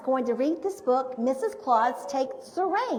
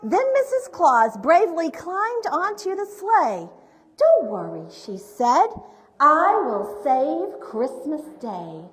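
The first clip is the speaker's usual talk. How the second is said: projected, rhyming picture-book narration; story reading to children; 4 semitones higher, 9 dB louder, slower than usual. A narrator's reading. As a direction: read the rhymes out with big, deliberate emphasis.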